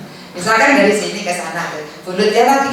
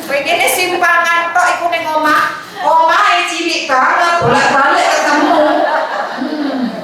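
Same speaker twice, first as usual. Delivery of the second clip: annoyed wife; 7 semitones higher than usual. Acted.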